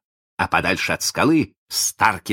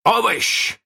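high, eager questioning; guessing pirate; 3 dB louder than usual. A narrator's reading. Hook guesses who the voice is, questioning. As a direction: toss out a guess, pitched high.

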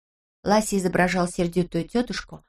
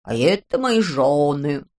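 slow, lower, self-important gentleman; pompous gentleman; 5 semitones lower, 4 dB louder, slower than usual. Acted. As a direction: play a grand gentleman. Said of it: "сказала Бритта". (важный господин)

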